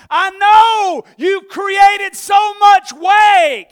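high, loud, slow retort; friend yelling back. Retold or imitated. Friend on the dock yelling back at the driver. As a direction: shout the blame back across the water.

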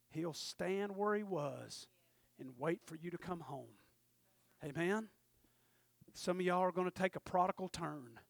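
ordinary talk

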